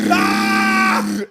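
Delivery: loud high yell of being hit; pained scream. Pretend. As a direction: scream as if shot. Hit by the door's gunfire.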